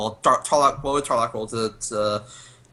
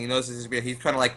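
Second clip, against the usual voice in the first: normal talking voice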